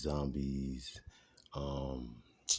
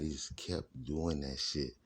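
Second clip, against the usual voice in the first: normal talking voice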